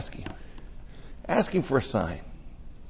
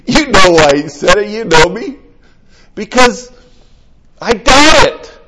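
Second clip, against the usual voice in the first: much higher, louder, near breaking; emotional outburst; 11 semitones higher, 13 dB louder than usual. Retold or imitated. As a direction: pour it out as an emotional confession. Retells own emotional answer to friends.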